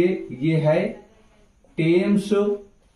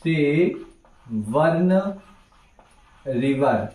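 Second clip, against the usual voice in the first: सेबर्न stretched out very slowly; slow dictation; much slower than usual. Shown as a demonstration. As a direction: draw the word out while writing. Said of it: "इसका मैं नाम लिख देता हूँ".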